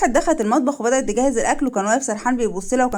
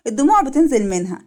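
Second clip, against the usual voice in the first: usual speaking voice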